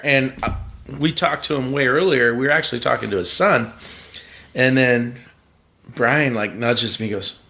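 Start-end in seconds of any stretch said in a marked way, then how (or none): none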